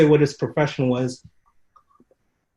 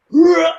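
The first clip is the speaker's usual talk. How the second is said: a high, loud battle roar; mock roar; 11 dB louder than usual. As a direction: roar like a fighter gone wild. Opponent snaps and charges.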